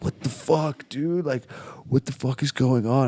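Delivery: high, rising bewildered questions; lost and spooked, asking what's happening; tripping teen at the seven eleven, baffled and high-pitched; baffled freak-out. Retold or imitated. Confused tripping teen reacting.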